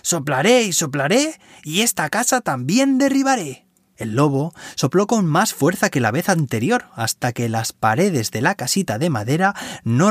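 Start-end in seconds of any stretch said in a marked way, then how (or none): 0.0-3.7 high menacing wolf threat. A narrator's reading. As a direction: warn them like an angry wolf. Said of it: "les advirtió". (voz de lobo amenazante)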